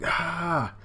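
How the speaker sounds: imitated scream of a trapped fighter; acted cry of alarm. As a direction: let out a hammed-up high "aah". Acting out character wrapped up by vines.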